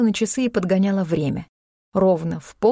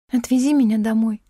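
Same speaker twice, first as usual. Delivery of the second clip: small, mildly pitiful request; plaintive; 3 semitones higher than usual. A narrator's reading. Heroine asks Klim to take her home, plaintive. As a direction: ask meekly, a touch plaintive. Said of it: "попросила я жалобно".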